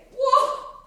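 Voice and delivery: high, sudden wail; crying out. Acted. Preacher voicing Isaiah's cry, crying out. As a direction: cry out high, as if struck with dread. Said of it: "is saying".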